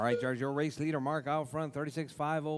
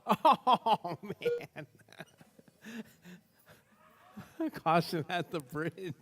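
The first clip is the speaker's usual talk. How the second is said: laughing, gasping for air; breathless from laughing, gulping air; out of breath; 5 semitones higher, 4 dB louder, much slower than usual. Real, not acted. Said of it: "had a hard time catching my breath".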